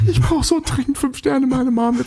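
put-on high, whiny begging child voice; pleading like a little kid. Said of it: "Klingt beide gerade ein bisschen bettelnd".

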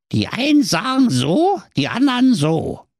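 ordinary delivery, nothing marked

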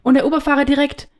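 ordinary talk

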